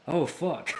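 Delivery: high, laughing swear; retold shock dissolving into laughter; laughing oh fuck. Retold own reaction, laughing.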